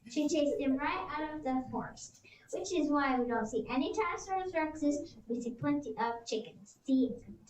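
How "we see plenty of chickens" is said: ordinary delivery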